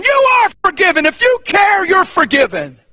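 high, forceful preacher's rebuttal; insistent exclamation; emphatic assurance. Acted. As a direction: overrule the doubt firmly. Emphatically answers an imagined doubter.

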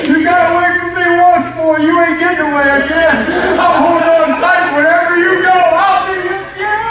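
high, loud and determined; Mary grabbing Jesus, shouting with joy; fierce, clinging shout. Acted. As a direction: yell it like she'll never let go.

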